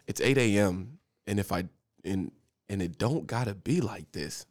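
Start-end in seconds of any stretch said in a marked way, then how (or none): none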